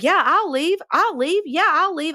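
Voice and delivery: high, taunting student daring the teacher; mocking defiant kid. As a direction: toss it off like a cocky teen calling a bluff. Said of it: "one of the kids is like".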